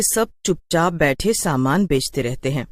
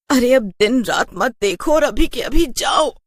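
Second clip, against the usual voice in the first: insistent shout; 7 semitones higher than usual. Acted. Wife shouting at her husband to go right now. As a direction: order him out the door now.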